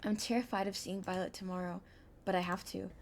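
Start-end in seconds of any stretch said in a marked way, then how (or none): none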